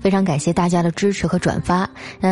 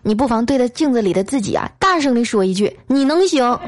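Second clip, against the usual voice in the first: raised, rousing exhortation; impassioned pep talk; 5 semitones higher than usual. Acted. Colleague cheering on a henpecked husband, impassioned. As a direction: declaim it like a stirring motivational speech. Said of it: "慷慨激昂地说".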